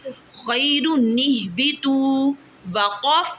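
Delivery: खैरु निहबितु recited slowly as one joined phrase; slow recitation. Shown as a demonstration. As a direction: chant it slowly, linking the नून. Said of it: "पहले मैं पूरा कलमा, पूरा पढ़ूंगी".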